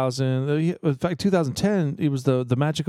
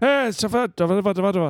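attempted impression; 7 semitones higher than usual. Shown as a demonstration. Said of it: "I gotta practice it".

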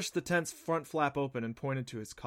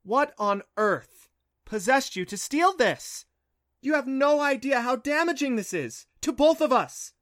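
upset scolding; 12 semitones higher, 9 dB louder than usual. Acted. Mother scolds son over theft.

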